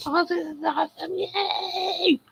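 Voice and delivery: very high squealing mock fan cheer. Mocking fans cheering at a wrestling show in a high squeal. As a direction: squeal it like a giddy fake fan. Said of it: "See your people going".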